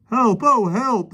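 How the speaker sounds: high, loud panicky cries; gasping for help. A narrator's reading. Policeman gasps for help as the lion hugs him, high panic. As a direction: gasp out for help. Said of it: "the policeman gasped".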